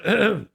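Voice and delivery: two loud throat clears; imitated throat-clearing. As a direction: mimic a man clearing his throat. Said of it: "he was clearing his throat".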